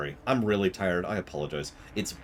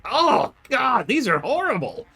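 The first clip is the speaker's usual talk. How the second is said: high, loud exasperated cry; hammed-up disgust at bad movies; mock horrified groan; 14 semitones higher, 4 dB louder, slower than usual. Watching Transformers, crying out in mock horror. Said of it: "and I'm just like".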